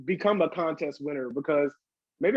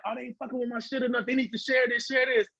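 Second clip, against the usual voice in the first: put-on childish inner artist; 6 semitones higher than usual. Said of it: "It's that part of me like".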